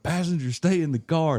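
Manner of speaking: a hint of a cop bellowing from the roadside; stern trooper order. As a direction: snap the order like a state trooper. Trooper barking order at passenger.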